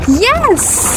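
high triumphant yes; excited yelp. Real, not acted. Finally finds healing.

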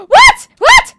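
very high, very loud cries of disbelief; shocked shout. Real, not acted. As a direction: shriek in sudden surprise. Sudden surprise in game.

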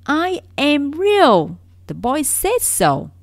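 very loud, high, slow plea; cling to each word, pleading; desperate insistence. Acted. Little rabbit nearly crying.

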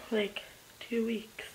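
soft slow hush; whispered correction. Real, not acted. Vlogger correcting a friend in a whisper. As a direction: say it under your breath.

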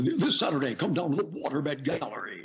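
high, hyped-up local ad read; local disc jockey. Retold or imitated. Teen voice actor mocking local disc jockey commercials, disc jockey. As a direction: mimic a generic radio pitchman selling hard. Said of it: "they all sounded exactly the same".

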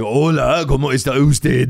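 mock Spanish teacher with a thick Japanese accent. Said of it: "like, a Japanese".

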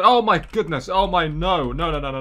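high, loud gasp of dismay; shocked cry. Real, not acted. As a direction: cry out as the yolk spills. Eggs break in the game.

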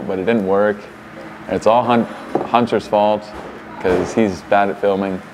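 ordinary delivery

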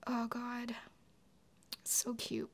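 soft and tearful; teary hush. Real, not acted. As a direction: swallow the tears, barely voiced. Still emotional after crying.

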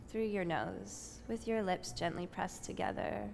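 soft, unhurried yoga teacher voice; guided breathing meditation. Acted. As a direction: ease the class into stillness with calm breath cues. Leads breathing meditation on mat.

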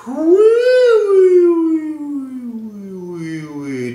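loud high woo falling down; descending woo slide. Shown as a demonstration. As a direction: slide woo from top to bottom.

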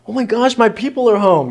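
high, gushing dog voice at the door; excited dog. Retold or imitated. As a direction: voice the dog thrilled its people are back. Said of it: "They're like".

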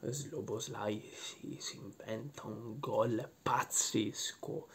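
slow, hushed whisper. Acted. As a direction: breathe it like a secret. Hushed build-up before describing goal.

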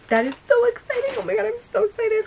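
high-pitched, excited squealing; thrilled squeal; giddy excitement. Real, not acted. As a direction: gush high, rushing into the second line. Overjoyed by the gift.

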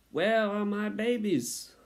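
high, drawn-out, loud mother mouse wail; exhausted mother. A narrator's reading. New mother calls for her babies, exhausted high wail. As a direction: cry out slowly in a high put-on voice. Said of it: "said the exhausted mother".

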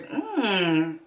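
normal talking voice

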